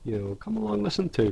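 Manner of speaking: a light hammed-up host intro; cheery podcast intro. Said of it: "I like how we've gone from".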